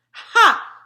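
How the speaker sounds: loud, sharp giant's ha; triumphant ha. Acted. Giant about to reveal dark secrets, loud ha. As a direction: bark a proud ha.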